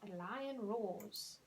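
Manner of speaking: slow, careful model sentence; slow teacher model. Shown as a demonstration. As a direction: say it slowly. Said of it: "You got it".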